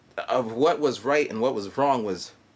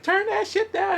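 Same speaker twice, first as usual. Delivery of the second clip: 1 yelled complaint. Retold or imitated. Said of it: "people are just like".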